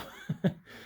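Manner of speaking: breathy little chuckle; quick airless laugh; soft laugh. Real, not acted. Laugh after apology.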